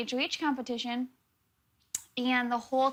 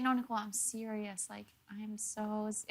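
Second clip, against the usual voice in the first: softer, earnest imitation of Zach; mock serious hush; 9 dB quieter than usual. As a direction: hush it like a fake confession.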